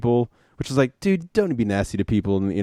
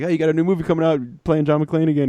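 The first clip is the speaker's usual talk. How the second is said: higher, chatty imitation of a live interviewer; mock reporter small talk, pitched up; 6 semitones higher, faster than usual. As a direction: put on an upbeat courtside-interview voice. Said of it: "one reporter".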